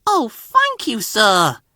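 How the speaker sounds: delighted high-pitched little engine; happy. A narrator's reading. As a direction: burst out with joyful thanks, voice leaping up. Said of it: "said Percy happily".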